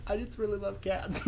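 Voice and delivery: soft, imitated near-tears confession; mock tearing up. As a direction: go small and mock-tearful. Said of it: "She tears up".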